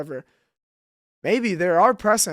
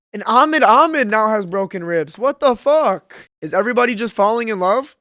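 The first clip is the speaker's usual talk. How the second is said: exasperated shout; 3 semitones higher, 8 dB louder than usual. Real, not acted. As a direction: bellow it in disbelief. Frustrated outburst over injury.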